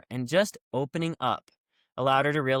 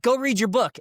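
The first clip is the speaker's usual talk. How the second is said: barked order, higher and louder; mock drill yell; 10 semitones higher, 6 dB louder than usual. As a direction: bark it like a drill instructor. Instructor yells command.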